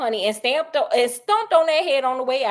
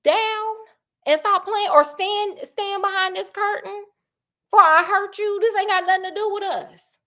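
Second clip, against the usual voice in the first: stern threat; 8 semitones higher than usual. Retold or imitated. Sherlock holding Watson back behind the curtain, stern and threatening. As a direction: hold your friend back with a threat. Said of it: "Sherlock grabbed him and was like".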